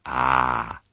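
soft sheep murmur. Acted. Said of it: "they all said softly".

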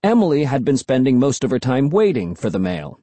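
ordinary delivery, nothing marked

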